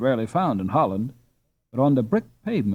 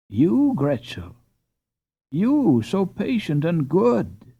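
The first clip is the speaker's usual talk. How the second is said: surprised; 5 semitones higher, 3 dB louder, slower than usual. A narrator's reading. Mother surprised by confession.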